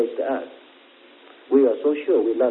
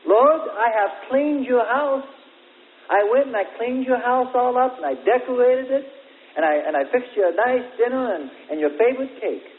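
eager boasting; 9 semitones higher than usual. Acted. Preacher voices believers boasting to Lord.

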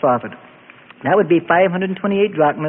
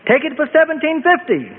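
higher, exasperated concession; upset; 12 semitones higher than usual. A narrator's reading. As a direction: throw up your hands as you give ground. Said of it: "sounding upset".

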